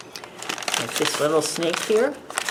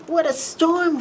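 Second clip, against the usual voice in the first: much higher, chirpy little bird voice; twittering wren; 9 semitones higher than usual. Acted. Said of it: "twitters wren".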